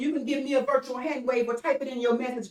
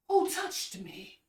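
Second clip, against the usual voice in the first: soft slow quote; 4 semitones higher, 6 dB quieter, slower than usual. Retold or imitated. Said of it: "Jesus said".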